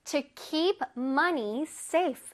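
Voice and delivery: I read books at the library, every word drawn out; slow example sentence. Shown as a demonstration. Teacher reading an example sentence slowly to kids. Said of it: "왜?".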